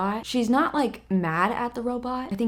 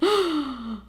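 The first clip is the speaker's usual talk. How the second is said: sharp shocked intake of breath; real gasp; 5 semitones higher than usual. Real, not acted. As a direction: gasp as if slapped by the words. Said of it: "That's what I'm gasping at".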